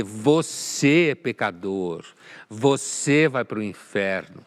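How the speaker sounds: slow, loud imitation of a condemning inquisitor; mock accusing. Commentator imitating a finger-pointing zealot condemning a sinner, mock accusing. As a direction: point the finger with each drawn-out, emphatic phrase. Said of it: "Essa coisa de apontar o dedo e dizer".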